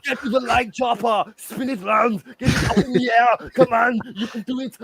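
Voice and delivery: high, loud Arnold pep talk; rally them as an action hero; urgent Arnold-style shouting; Arnold impression. Arnold impression urging likes.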